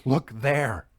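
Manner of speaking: a sudden, mild startled cry; startled exclamation. A narrator's reading. Entranced clairvoyant startles the sitters: mild sudden exclamation. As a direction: jolt out of the trance and point. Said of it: "started and exclaimed".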